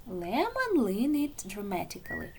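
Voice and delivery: ordinary delivery, nothing marked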